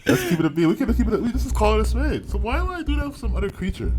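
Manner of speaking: high pitched emphatic joking; excited high. Real, not acted.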